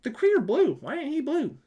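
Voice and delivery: high, disbelieving question; can't believe he isn't blue; baffled. Real, not acted. Fan to friends about a movie, a high baffled complaint. Puzzled rant about the Kree.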